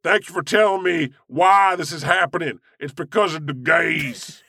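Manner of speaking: high, drawling fake-grateful homophobe; mock Southern drawl. Mocking thanks to the televangelist in a high Southern drawl. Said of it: "I like impersonating homophobes".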